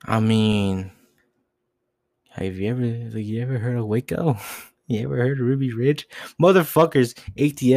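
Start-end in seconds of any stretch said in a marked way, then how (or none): 6.4-7.7 angry outburst. Real, not acted. Outrage at ATF.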